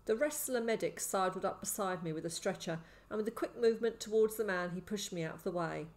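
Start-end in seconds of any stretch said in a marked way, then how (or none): none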